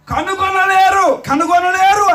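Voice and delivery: high, slow drawn-out warning; solemn proclamation. Acted. Preacher repeating God's verdict to the church, high and slow. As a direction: stretch each word like a verdict.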